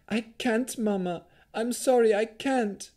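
put-on high-pitched, distressed little girl; tearful child. A narrator's reading. Exhausted little Rowan to her mother, high tearful plea. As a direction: plead helplessly in a small high voice. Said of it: "Tears streamed down Rowan's face".

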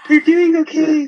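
high, loud mocking encouragement; mock praise. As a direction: pitch it up like faked approval. Mocks praise for mediocre results.

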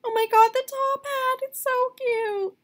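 high gushing squeal; squealing excitement. Real, not acted. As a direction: squeal with delight at it. Gushing over doll outfit.

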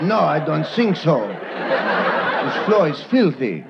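slow, haughty French dog voice; a sniffy dog with a beret, slowly; snooty French dog. Retold or imitated. French dog refuses to sit.

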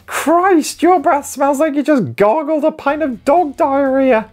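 foul-mouthed sock puppet. Acted. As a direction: yell insults like a crude puppet. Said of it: "one-eyed, foul-mouthed sock puppet".

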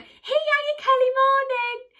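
high, loud cheery hello; bright greeting. Retold or imitated. Greeting the cleaner she thinks is her teacher, high cheery.

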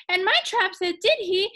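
high, winded fisherman; panting. A narrator's reading. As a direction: push the words out between pants. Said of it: "The fisherman panted".

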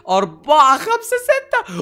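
counting up, voice climbing to a squeal; rising excitement as the count grows; excited counting. Real, not acted. Counting gold finds excitedly.

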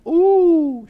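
very high, loud mock-impressed ooh; hammed-up high-pitched cooing; affected woman's coo. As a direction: swoop up high like an impressed socialite. Mimics the impressed glamorous woman.

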